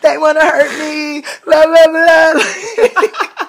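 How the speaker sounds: high, loud hammed-up crying whine; fake-blubber the complaint, laughing through it; mock sobbing complaint. Retelling her crying complaint.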